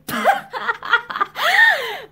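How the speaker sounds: high, loud, acted harsh laughter. A narrator's reading. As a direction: laugh out harshly, without joy. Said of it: "Lynn laughed harshly".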